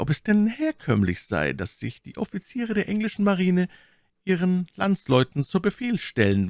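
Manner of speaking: hoch gesprochene Mylady, misstrauisch. A narrator's reading. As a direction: als misstrauische Lady, deutlich höher. Voiced Milady. (Mylady fragt den Offizier misstrauisch, hohe Stimme)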